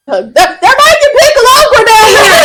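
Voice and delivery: high, loud mock Southern twang; hammed-up Southern accent through laughter; strong Southern accent. Imitating how she talks to Bailey, mock Southern accent. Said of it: "she's got that strong Southern accent".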